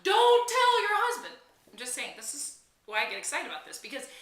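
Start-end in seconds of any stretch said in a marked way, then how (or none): 0.0-1.3 slow, much higher and louder warning; yelling advice at the movie screen. Shown as a demonstration. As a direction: shout a warning at the heroine. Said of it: "you can yell".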